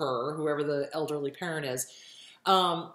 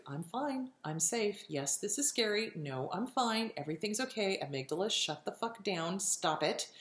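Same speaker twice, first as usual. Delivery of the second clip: soft, calming self-talk; self-soothing; 6 dB quieter than usual. Shown as a demonstration. Calming oneself during panic, soft soothing self-talk. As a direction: quietly talk yourself down. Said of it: "you just breathe and you self-soothe".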